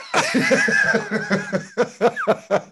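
high giggling burst of laughter; laughing. Real, not acted. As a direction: laugh it off, embarrassed. Embarrassed about pimp interview.

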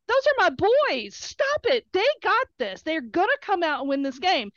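high-pitched, indignant scolding; re-enacted protective outburst; retold fierce defence. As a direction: defend your boys, voice pitched right up. Retold defence of team to hecklers.

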